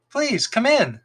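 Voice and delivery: put-on high, light boy voice; fox boy voice. A narrator's reading.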